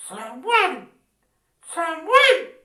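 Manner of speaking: low, booming trumpet call. Acted. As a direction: call the name like a trumpet blast. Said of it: "le dijo con voz de trompeta".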